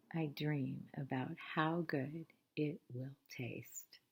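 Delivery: soft, low, dreamy murmur; dreamy whisper. Acted. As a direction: hush it like a sweet dream. Dreaming of the lollipop.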